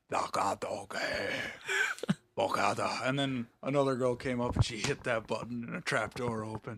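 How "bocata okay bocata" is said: put-on alien servant speech; movie creature voice. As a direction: imitate an alien henchman's strange tongue. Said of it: "and she went".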